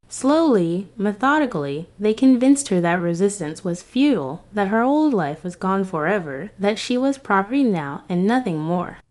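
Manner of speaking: usual speaking voice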